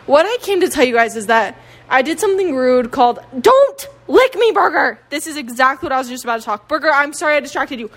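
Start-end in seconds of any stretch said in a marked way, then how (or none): 3.4-5.0 high, loud, drawn-out scolding; scolding a dog. Real, not acted. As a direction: cry out at the dog to stop. Scolding the dog mid-sentence.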